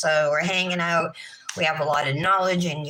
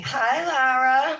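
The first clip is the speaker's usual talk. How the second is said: sing-song; 6 semitones higher, 3 dB louder, slower than usual. Real, not acted. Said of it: "I talk in a sing-song voice".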